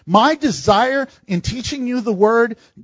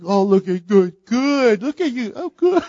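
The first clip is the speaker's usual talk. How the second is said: put-on praising a baby. Parent praises baby eating solid food, cooing. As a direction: coo at a toddler eating.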